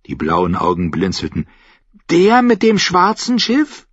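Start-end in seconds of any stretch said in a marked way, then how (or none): 2.1-3.9 put-on high, slow child's voice; high-pitched boy, impressed; curious boy. A narrator's reading. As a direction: ask it slowly in a high boyish voice.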